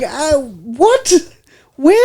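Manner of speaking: high-pitched, baffled disbelief; hammed-up incredulous questioning; comic bewilderment. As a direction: retell it as utterly thrown, voice jumping up. His reaction to her praise.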